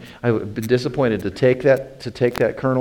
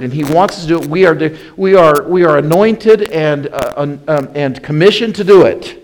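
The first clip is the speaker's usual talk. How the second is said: loud, raised pulpit preaching; loud, high, driving sermon voice; fiery preaching; 6 semitones higher, 11 dB louder than usual. Acted. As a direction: preach it out full force to the congregation. Preaching at full force.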